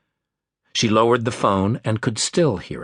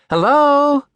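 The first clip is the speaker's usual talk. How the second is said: high, loud call across the garden; a bright hopeful hail; 7 dB louder than usual. Acted. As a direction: call out to the hidden man. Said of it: "she called again".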